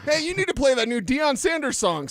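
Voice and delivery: fake caller voice. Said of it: "have him say that line".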